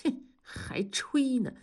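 slow, pouting scoff; a touch of scornful disbelief. Acted. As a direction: curl your lip and dismiss his bragging. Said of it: "同志撇撇嘴儿".

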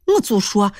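ordinary delivery, nothing marked

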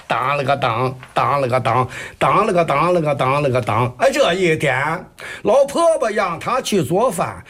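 rhythmic Shandong storytelling chant in Jinan dialect; 济南话山东快书说唱; Jinan dialect Shandong kuaishu. Acted. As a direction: rattle it off like a Shandong kuaishu performer. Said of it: "您这个济南话 很标准呀".

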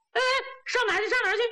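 high, flustered repeated question; startled questioning. Acted. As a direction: ask in a bewildered rush. Startled man being hurried off.

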